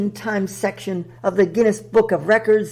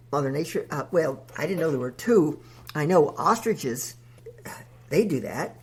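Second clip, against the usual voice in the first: lower, softer sheepish reply; deep, timid host; 5 semitones lower, 4 dB quieter, faster than usual. Acted. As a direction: shrink back in a low, hesitant voice.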